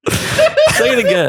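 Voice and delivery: high, slow words through laughter; can barely get it out for laughing. Real, not acted. Asking a friend to repeat a joke, laughing.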